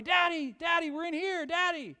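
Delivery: put-on high, loud child's voice crying for daddy; child calling out. Trapped boy calling up to his dad. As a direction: call out from under the rubble.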